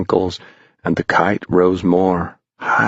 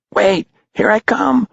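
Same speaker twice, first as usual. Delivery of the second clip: put-on much higher, eager boy calling out; excited cry. Beggar running to join the tail, high excited call. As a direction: jump in with a high eager shout. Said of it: "cried Beggar".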